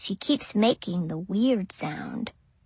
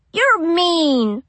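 put-on higher, louder, tearful child; crying; 6 semitones higher, 5 dB louder than usual. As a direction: speak through childish tears. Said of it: "I'm crying".

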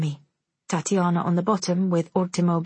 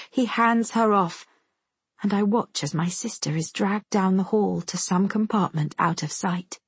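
usual speaking voice

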